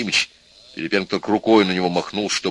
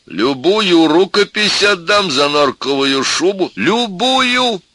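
high, slow, loud wail of grief; tipsy anguished cry; 11 semitones higher, 6 dB louder, slower than usual. A narrator's reading. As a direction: cry out in utmost despair. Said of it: "вскричал пьяненький профессор в величайшем горе".

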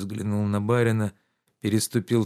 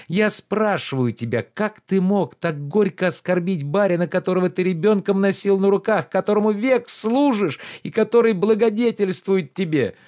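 higher, louder reproachful gentleman; lordly rebuke, pitched up and loud; indignant master Oblomov; 9 semitones higher, 5 dB louder than usual. Acted. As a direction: scold the servant with wounded pride.

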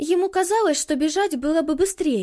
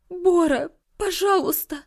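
desperate plea; 5 semitones higher than usual. Acted.

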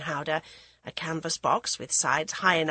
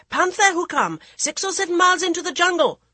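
urgent huntsman; 12 semitones higher, 8 dB louder than usual. Acted. Huntsmen report the panther.

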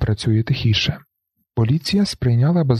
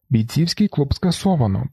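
ordinary delivery, nothing marked

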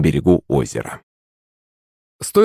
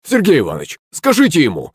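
higher-pitched appeal for help; pleading cry; 7 semitones higher than usual. A narrator's reading. Servant appealing to Сергей Иванович to step in, crying out. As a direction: cry out in complaint. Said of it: "вскрикнул слуга".

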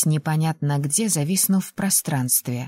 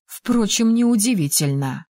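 usual speaking voice

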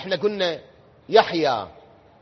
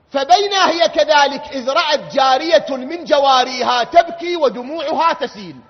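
loud, raised sermon chant; fervent preaching; 5 semitones higher, 7 dB louder than usual. Acted. Preacher narrating to mourners, loud fervent chant. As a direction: carry the hall with the story.

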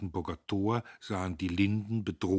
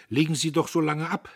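higher and louder, a lordly graciousness; graciously; 9 semitones higher, 9 dB louder, faster than usual. A narrator's reading. Dietrich offers Agnes to take off her coat, gracious. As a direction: grant it like a favour from above. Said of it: "sagte Dietrich gnädig".

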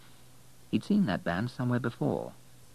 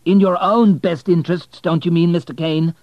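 sharp, raised, much higher and louder; hot anger; 10 semitones higher, 12 dB louder than usual. Acted. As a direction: fire the accusation back hotly. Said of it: "said Abel hotly".

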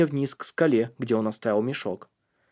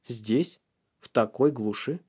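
plain reading voice

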